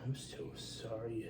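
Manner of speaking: soft, slow little apology; imitated apologetic child. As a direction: quote a small girl's sorry, drawn out. Father voicing his apologetic daughter.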